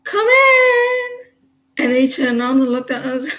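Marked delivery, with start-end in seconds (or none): 0.0-1.1 high, loud call of come in. Retold or imitated. Calling to the man at the door, high shout. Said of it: "and then I goes".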